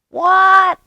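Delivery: high loud yell of what. A narrator's reading. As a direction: yell it over the din. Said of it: "yelled a muskrat".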